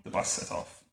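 ordinary delivery, nothing marked

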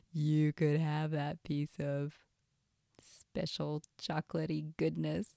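sneaky tempter. Acted. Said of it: "almost like it's whispering in your ear".

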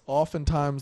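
ordinary talk

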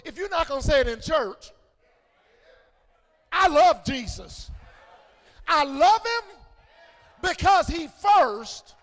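loud, rising declarations of devotion; fervent preaching. Real, not acted. Preacher declaring love for Jesus in church, fervent shouting. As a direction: belt out each phrase with fervor.

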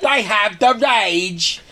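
high, drawled mock-British; hammed-up rustic British caricature; bumpkin British accent. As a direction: draw it out in bad British. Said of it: "This country bumpkin".

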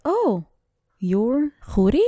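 high, drawn-out disbelieving question; slow mock astonishment, rising high; retold surprise. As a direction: imitate someone taken aback. Imitating caller's surprise on meeting her.

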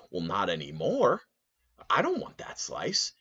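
indignant refusal. Retold or imitated. Refusing the slice she touched, high indignant disgust. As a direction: pitch it way up in disbelief.

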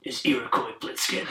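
whispered tough-soldier impression; imitating a rough covert-ops voice; Solid Snake voice. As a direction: breathe it out like a hardened soldier. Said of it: "he talks like that".

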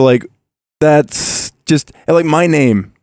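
ordinary talk, nothing marked

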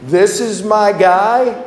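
hammed-up dismay, slow and loud; incredulous repeat. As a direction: stretch it out like you can't believe your luck. Repeated in disbelief, drawn out.

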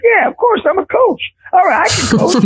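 high-pitched, eager self-quote; eager acceptance. Retold or imitated. Preacher agrees to coach basketball, high and eager. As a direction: jump at the offer in a high voice. Acting out his eager yes.

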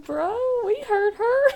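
mock friends. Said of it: "all your friends are like".

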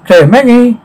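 high searching call. Acted. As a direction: call out for the missing ones. Searching for Claire and Maggie.

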